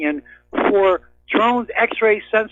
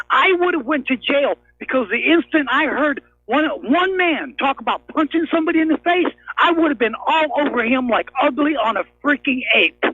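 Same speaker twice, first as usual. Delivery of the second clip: higher-pitched angry rant, slowing at the end; pissed off; 4 semitones higher than usual. Real, not acted. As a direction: rail at them in real disgust. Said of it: "'cause I'm pissed off".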